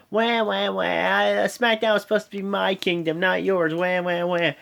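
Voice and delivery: mock her like a whiny child; mock whining. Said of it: "Natalya comes out".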